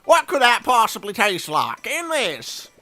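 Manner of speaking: a cook worried the recipe will cost him his job; nervous old cook. Acted.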